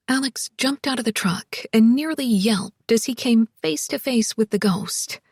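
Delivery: usual speaking voice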